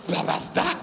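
high breathy whispered question; high whispered what was that; startled whisper. Acted. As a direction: whisper in alarm.

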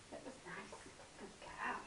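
soft whispered praise; whispering. Real, not acted.

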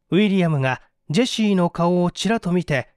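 plain reading voice

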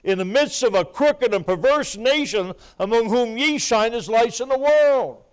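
high, loud revival preacher cadence; full-force preaching. Real, not acted. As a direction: build to a shouted sermon, each phrase arching high and falling.

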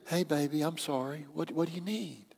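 soft, gentle apology to a partner; hushed, placating voice; bass-free soft apology. Shown as a demonstration. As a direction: drop the volume right down and soothe. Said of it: "take the bass out of your voice".

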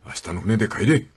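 low, heavy refusal in Kyushu dialect; retelling the father in the film, low and heavy; heavy father's voice. Retold or imitated. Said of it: "森重は重たい声で、".

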